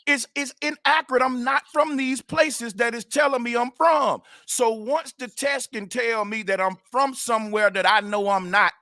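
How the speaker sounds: normal talking voice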